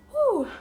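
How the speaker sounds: loud, high exhaled whew; high whew of relief. Real, not acted. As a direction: blow out the emotion with a whew. Composing herself after emotional story.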